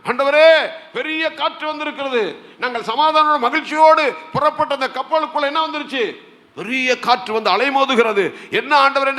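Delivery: high-pitched pleading, acting out people crying to God; imitated desperate cry. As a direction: cry out to the Lord in mock distress. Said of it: "கர்த்தரை நோக்கி கதறாங்க". (கதறி முறையிடுவது போல)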